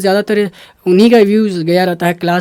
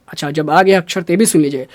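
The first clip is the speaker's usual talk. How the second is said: ordinary delivery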